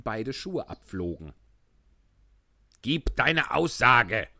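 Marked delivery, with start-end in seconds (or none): 2.8-4.3 angry. Acted. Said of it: "wiederholte der König ärgerlich".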